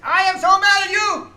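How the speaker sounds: shouted high and loud in a mock rage; hammed-up angry shout from the professor; furious yell. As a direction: bellow it like a cousin blowing his top. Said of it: "and boy, was he mad".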